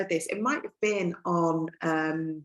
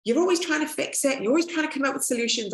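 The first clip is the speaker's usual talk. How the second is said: put-on higher, fast complaining teenagers; teen kids complaining; 7 semitones higher, faster than usual. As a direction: needle mum for always fixing. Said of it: "they all said to me".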